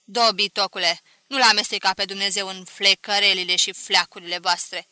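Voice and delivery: loud sharp scolding; snapping rebuke. A narrator's reading. Grandmother snapping at Mișu for blasphemy, loud rebuke. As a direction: snap at him like a stern grandmother. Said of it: "se răsti".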